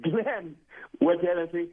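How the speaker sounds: usual speaking voice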